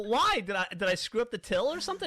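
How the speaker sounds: high, loud mock disbelief; retold outburst, leaping up in pitch; incredulous protest. Demoted worker asks the manager why, incredulous. Reacts to being put on dishes.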